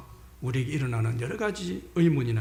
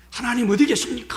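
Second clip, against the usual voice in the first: raised, much higher acted plea; crying out; 9 semitones higher, 5 dB louder than usual. Sufferer before God asking where he is, crying out. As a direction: call out to God in demand. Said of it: "부르짖고 질문하는 것".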